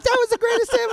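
put-on high-pitched gleeful kid voice; excited eleven-year-old. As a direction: squeal it like a thrilled kid. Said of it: "eleven-year-olds go by me".

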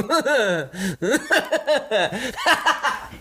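high, hammed-up fit of laughing; uncontrollable spell-induced laughter. Game master playing a man hit by a laughter spell, high helpless cackling. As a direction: cackle helplessly in rising bursts. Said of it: "you see him go".

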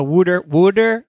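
"wooder" drawn out slowly, first vowel like "wood"; Pennsylvania accent. Retold or imitated. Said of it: "the Pennsylvania wooder".

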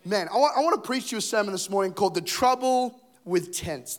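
ordinary talk, nothing marked

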